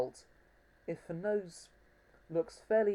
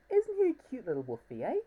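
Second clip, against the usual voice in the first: put-on high, sing-song baby talk; cutesy cooing; 5 semitones higher, 3 dB louder than usual. Showing off his new plush wolf, high cutesy coo. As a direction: coo over the toy like a pet.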